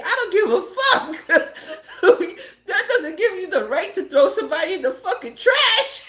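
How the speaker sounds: high-pitched outburst through real laughter; laughing mock indignation. Real, not acted. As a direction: argue it while cracking up. Retelling argument, bursts out laughing.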